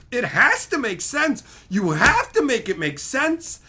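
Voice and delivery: high, loud insistent demand; exasperated outburst; rebelling brain. Acted. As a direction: plead and insist at the top of your pitch. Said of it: "My brain fucking rebels".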